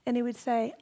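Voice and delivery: ordinary delivery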